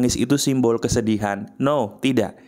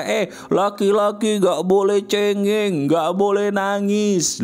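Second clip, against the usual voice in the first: slow, higher mock-stern scolding of a boy; stern elder's warning; 5 semitones higher, slower than usual. Quoting what fathers tell boys, slow mock-stern scolding. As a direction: wag a finger like a strict father, drawn out. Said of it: "kata siapapun berkata".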